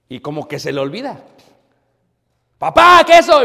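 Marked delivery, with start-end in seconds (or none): 2.6-3.4 put-on loud, high child's shout over headphones; shouting child with earbuds in; kid shouting. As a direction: yell like a kid who can't hear himself. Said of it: "me empieza a gritar".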